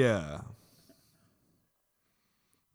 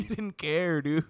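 mock dude voice; 7 semitones higher, 4 dB louder, slower than usual. Mocking friend's voice, repeated.

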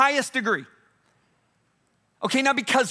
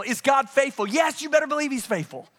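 ordinary talk, nothing marked

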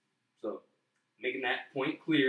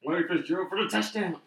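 mock announcer call; 6 semitones higher, 4 dB louder than usual.

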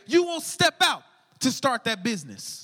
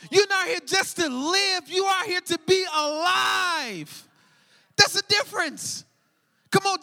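full-force preaching; 4 semitones higher than usual. Acted. Pastor rallying the congregation, preaching at full force. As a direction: whip the church up to their purpose.

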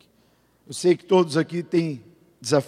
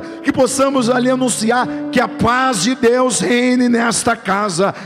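raised, louder preaching prayer voice, pressing on; fervent prayer; 7 semitones higher, 4 dB louder than usual. Acted. Pastor praying over the congregation, fervent and raised. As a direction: pray it out over the church with full force.

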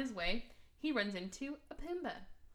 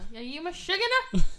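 Yiddish mom; 9 semitones higher, 10 dB louder than usual. Retold or imitated. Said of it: "starts throwing in Yiddish".

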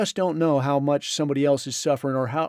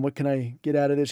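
ordinary delivery

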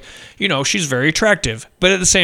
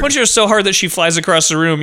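normal talking voice

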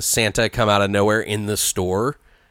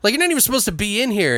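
dismissive retort; 11 semitones higher, faster than usual. Retold or imitated. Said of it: "And he's like".

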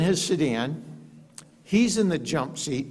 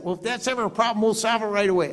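the president's voice; 8 semitones higher, faster than usual. Retold or imitated. President reassuring about the bosses.

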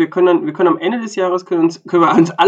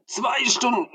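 moaning quote; 9 semitones higher, 4 dB quieter, slower than usual. Retold or imitated.

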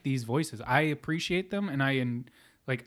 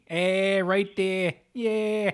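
mock Yinzer woman, pitched higher; Yinzer accent; 6 semitones higher than usual. Mocking a Pittsburgh woman in bed, Yinzer accent. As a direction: ham up a Pittsburgh Yinzer moan. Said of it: "that fucking gravelly smoker Yinzer accent".

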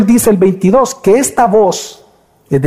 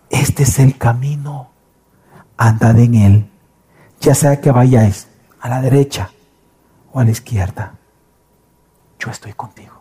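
speaking into the ear; 8 semitones lower, 3 dB quieter than usual. Acted. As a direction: murmur it close, with long pauses, fading almost to nothing. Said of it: "se acerca a ti y te hablara al oído". (hablando bajito al oído)

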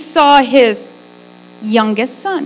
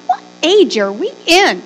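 shocked; 6 semitones higher than usual. Retold or imitated. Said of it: "I know it shocked me. I'm like".

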